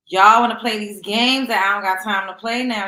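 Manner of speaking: loud, punchy callout. Acted. Calling out viewers loudly after whispering it. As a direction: throw it at them full voice.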